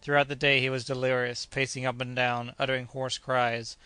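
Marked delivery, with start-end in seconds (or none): none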